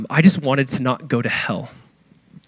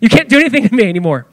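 higher, cocky mock challenge; taunting death; 6 semitones higher, 3 dB louder than usual. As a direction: jeer at death like a beaten bully. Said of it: "taunt death with the same spirit".

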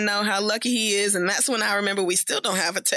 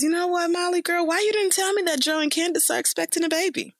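sly quote; 7 semitones higher than usual. Retold or imitated. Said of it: "Tiffany says".

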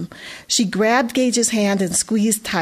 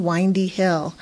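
usual speaking voice

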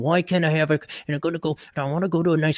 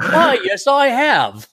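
high, hammed-up British reply; high-pitched mock-British voice; 10 semitones higher, 4 dB louder, much slower than usual. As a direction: answer as a delighted fake English gent. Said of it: "none of us have British accents".

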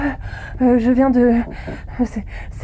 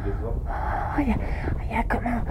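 whispering; 8 dB quieter than usual. Acted. Exploring quietly, awestruck.

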